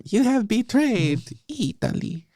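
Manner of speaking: high, melodramatic Italian accusation; hammy cartoon Italian lament; fake Italian accent. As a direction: accuse them in a mock-tragic Italian voice. Mock accusation in film's Italian voice.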